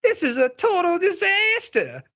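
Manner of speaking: very high, hammed-up drama queen. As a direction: squeal it like a theatrical diva. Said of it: "this is my drama queen voice".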